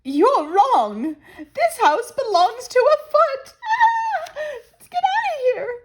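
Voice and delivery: high, loud panicky squeal with a cry; high squealing bird panic breaking into a cry; scared Missus Bird. Acted. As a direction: shriek and flee from the foot. Missus Bird frightened by a foot.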